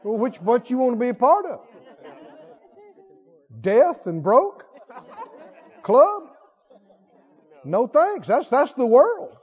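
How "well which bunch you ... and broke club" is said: chuckling through the words; laughing mockery. Real, not acted. Preacher poking fun at the critics, laughing. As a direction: tease the labels with a laugh.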